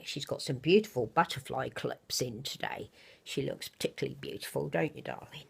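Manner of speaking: soft doting whisper; whispered aside. Real, not acted. Grandmother whispering to the child beside her, doting.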